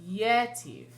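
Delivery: YE-tiv, accent up front; stress on first syllable. Shown as a demonstration. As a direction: lean hard on the first syllable of Yetiv. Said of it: "the first syllable is being accented".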